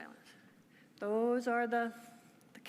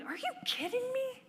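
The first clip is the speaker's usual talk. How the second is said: astounded; 12 semitones higher than usual. Retold or imitated.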